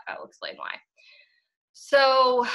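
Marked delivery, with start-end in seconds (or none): 0.1-0.7 whisper. Real, not acted. Quiet aside before explaining.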